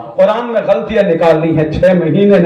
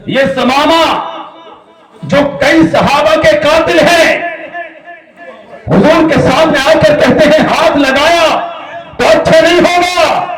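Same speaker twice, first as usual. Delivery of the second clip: high, ringing sermon cry; full-force preaching; 11 semitones higher than usual. Acted. (پرجوش، بلند آواز خطابت)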